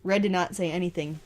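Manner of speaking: ordinary delivery, nothing marked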